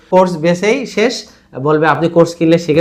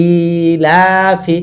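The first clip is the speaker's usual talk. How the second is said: ইলাফি with ই and লা slowly held, short ফি; two long stretches; much slower than usual. Shown as a demonstration. Teacher reading the whole word, slow stretched vowels in ইলাফি. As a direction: glide slowly through long ই and লা. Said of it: "লাতেও দুই হারাকাত দিচ্ছি".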